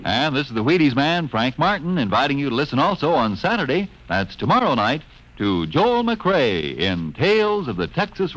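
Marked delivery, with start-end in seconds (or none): none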